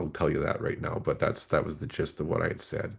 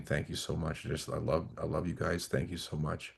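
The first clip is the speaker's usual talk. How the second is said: soft, much quieter grateful murmur; hushed thanks; 9 dB quieter than usual. Retold or imitated. Overwhelmed man thanking his guides, in a soft hushed murmur. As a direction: breathe the thanks out softly, almost to yourself.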